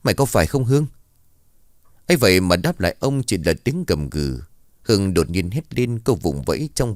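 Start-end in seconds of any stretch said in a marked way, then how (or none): 0.0-0.9 higher, raised demanding question; loud question. A narrator's reading. Father confronts his possessed daughter, mildly raised voice. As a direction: demand an answer from the possessed girl. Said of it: "ông Đường lớn tiếng hỏi".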